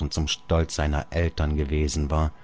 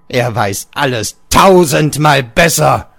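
much higher and louder exultant shout; triumphant cry; 8 semitones higher, 10 dB louder than usual. Acted. Father cries out to Georg in triumph. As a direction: crow it with the arm swinging overhead. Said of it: "rief er".

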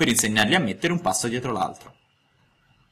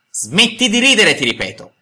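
threatening shout; 10 semitones higher, 7 dB louder than usual. A narrator's reading. Geppetto repeats the order to the puppet, a menacing shout. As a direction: bellow the warning as a last threat. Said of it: "urlò con voce minacciosa".

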